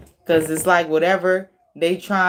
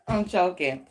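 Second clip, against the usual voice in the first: ordinary delivery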